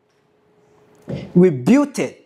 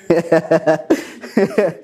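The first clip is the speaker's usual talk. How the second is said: embarrassed laugh. Real, not acted. Embarrassed laugh after crying.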